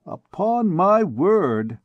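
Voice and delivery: put-on a vain officer admiring a girl aloud; fatuous captain. A narrator's reading. Captain to the ladies, fatuous and vain. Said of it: "in his tone of intrepid fatuity".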